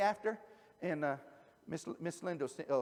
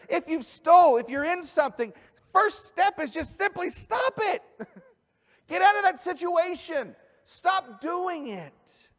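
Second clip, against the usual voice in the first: louder, higher, urging them to stop; fervent preaching; 11 semitones higher, 9 dB louder than usual. Real, not acted. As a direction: drive the point home with force.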